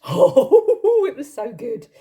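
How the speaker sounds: high laughing oh, so good; giggly, gushing praise; delighted laugh. Real, not acted. Delighted memory of caviar sandwich.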